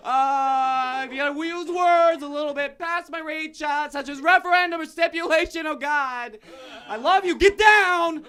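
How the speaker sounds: high, loud, strained yelling; furious dad rant. Acted. Father yelling at his son about double talk. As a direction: scream it like a father losing it. Ranting dad, confused cop.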